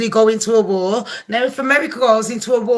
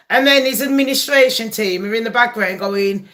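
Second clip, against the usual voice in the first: usual speaking voice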